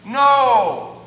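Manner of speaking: loud, high, called back; hollered reply. Retold or imitated. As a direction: holler it back across the water. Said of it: "they holler back".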